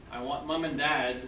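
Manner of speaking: ordinary talk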